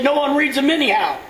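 high, loud mock scoff; scoffing stranger. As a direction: toss it off like the stranger.

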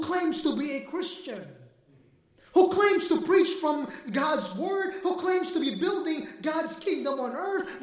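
fiery preaching. Acted. Pastor warns the church against false teachers, full-force preaching. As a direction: preach it hard to wake them up.

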